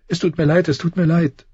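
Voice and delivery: put-on high, pleading childlike sob; sobbing girl. A narrator's reading. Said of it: "schluchzte sie".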